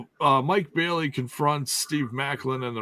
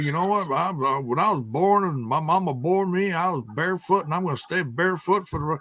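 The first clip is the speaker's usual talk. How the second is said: imitating a wrestler's Southern accent, higher; a lightly done Southern twang, fast at the end; 6 semitones higher, faster than usual. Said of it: "why he has a Southern accent now".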